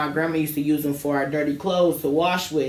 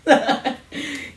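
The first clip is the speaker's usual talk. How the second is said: giggle; 10 semitones higher than usual. Real, not acted. Laughing at own joke.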